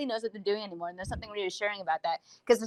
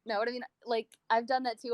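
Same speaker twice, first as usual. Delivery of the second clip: ordinary talk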